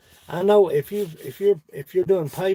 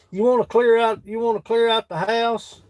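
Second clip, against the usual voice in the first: jokey excited call; 7 semitones higher, 4 dB louder than usual. Real, not acted. Joking advice called to John.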